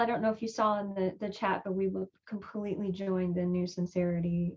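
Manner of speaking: ordinary talk, nothing marked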